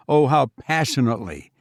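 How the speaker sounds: plain reading voice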